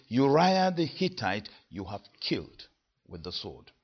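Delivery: Uriah the Hittite hit first and hardest; front-loaded stress on "Uriah". Shown as a demonstration. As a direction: lean into "Uriah the Hittite", then let the rest fall away soft. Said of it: "the word at the beginning is always the one emphasized".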